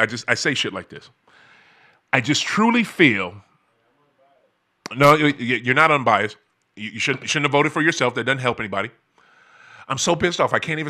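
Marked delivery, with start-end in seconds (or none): none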